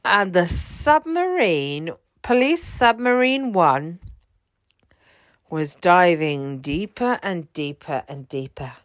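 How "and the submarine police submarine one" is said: ordinary delivery, nothing marked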